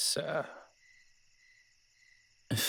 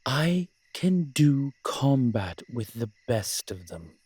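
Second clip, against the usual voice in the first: higher, louder and slow; indignant boast; 5 semitones higher, 5 dB louder, slower than usual. Acted. Incubus protesting he can fight, loud and slow. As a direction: insist on it, wounded pride. Defending his fighting skill.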